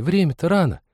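put-on high girlish coaxing; wheedling girl's voice; pleading girl. A narrator's reading. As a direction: argue it as a plea. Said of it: "просительно вернула первая".

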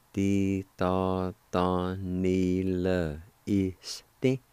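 "Ti ta ta ni le este." drawn out slowly and smoothly; slow, careful teaching pronunciation; very slow demo. Shown as a demonstration. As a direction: stretch every syllable of "Ti ta ta ni le este.". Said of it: "it's pronounced like this".

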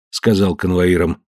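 ordinary delivery

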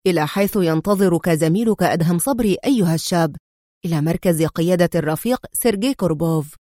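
plain reading voice

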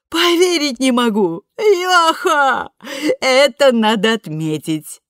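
high, loud, slow whooping joy; ecstatic whoop. A narrator's reading. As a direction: celebrate with a whooping cry. Ethel celebrating the deal.

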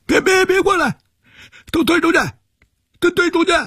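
high, panicked pleading voice. A narrator's reading. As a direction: beg them to back off. Scissors held to his throat.

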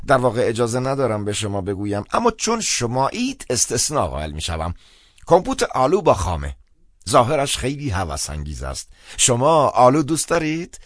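high-pitched, chatty clergyman voice; chatty phone priest, confiding. Acted. Priest tells caller about the dessert, confiding and high-pitched. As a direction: confide the menu in a high, lively voice.

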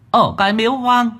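put-on very high, whining child-demon voice; pleading demon child. A narrator's reading. Demon child swears to the master it knows nothing. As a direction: insist it's the truth, whining.

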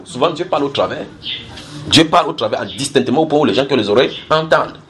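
usual speaking voice

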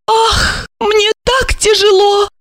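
high theatrical sigh of grief; sorrowful lament. A narrator's reading. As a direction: wail it like a widow in mock sorrow. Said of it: "искренне запечалилась".

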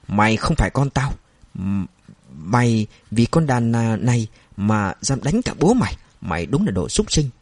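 plain reading voice

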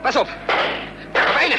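sharp high loud exclamation; startled cry. Acted. Hero at the wheel narrowly dodging: startled loud cry. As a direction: yelp at the near miss. Near miss while fleeing.

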